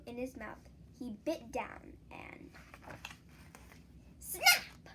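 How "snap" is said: loud high snap of breaking; sharp snap. A narrator's reading. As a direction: crack the word out sharply. Sound effect of breaking.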